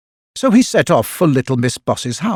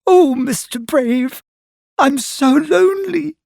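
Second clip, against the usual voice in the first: put-on high, sad pleading girl's voice; lonely acrobat begging Mister Brave, mildly tearful; sobbing; 14 semitones higher than usual. A narrator's reading. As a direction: plead much higher, a mock sob in it. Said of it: "she sobbed".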